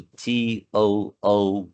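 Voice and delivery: T O O spelled slowly letter by letter; spelling out letters. Shown as a demonstration. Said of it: "escrita do mesmo jeito".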